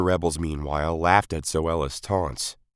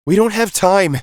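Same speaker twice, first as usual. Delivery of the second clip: put-on much higher, louder boy's voice; boy's interruption; 11 dB louder than usual. A narrator's reading. Misaka interrupts his mother, high and urgent. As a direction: cut in urgently as a young boy. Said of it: "Misaka interrupted".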